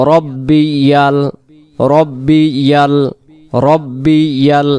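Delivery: রব্বি ইয়াল with ইয়াল as its own syllable; careful pronunciation. Shown as a demonstration. Teacher repeating for learners after spelling it, careful pronunciation. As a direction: slowly separate রব্বি from ইয়াল. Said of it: "ইয়া লাম জবর ইয়াল".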